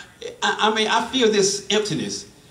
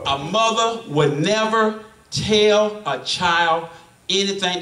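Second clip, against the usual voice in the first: slow emphasis; slower than usual. Real, not acted. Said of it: "slow emphatic preaching".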